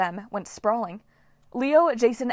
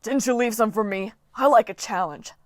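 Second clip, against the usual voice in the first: gruff coach; 4 dB louder than usual. Acted. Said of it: "he snapped at Jason".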